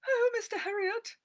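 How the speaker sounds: high, loud, fluttery lady's voice; anxious society lady greeting the vet; fussy lady. Acted. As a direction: gush at the vet. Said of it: "Missus Pumphrey said".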